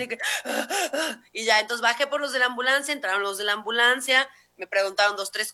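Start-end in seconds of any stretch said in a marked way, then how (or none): 0.2-1.3 high, panicky hyperventilating gasps; imitated panicked gasping; shocked gasps. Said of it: "yo estaba como en shock".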